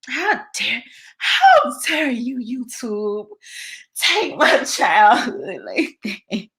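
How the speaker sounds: hammed-up Greta Thunberg accent, indignant. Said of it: "Did I get the accent right".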